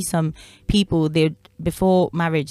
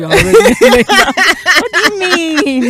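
slow high mocking drawl; mock them, stretched and high; 12 semitones higher, 7 dB louder, much slower than usual. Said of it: "I hate the way you guys say".

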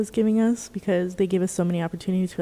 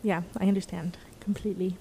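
whispering; 8 dB quieter than usual. Real, not acted. Whispered aside during chat.

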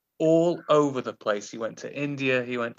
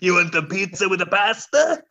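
louder, hammed-up Italian; mock Italian accent; 4 semitones higher, 4 dB louder than usual. Host mocking the accent specialist gone Italian, exaggerated accent. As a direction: rise into a cartoon Italian lilt. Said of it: "You got Italian".

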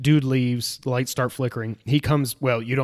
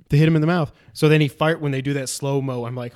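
ordinary delivery

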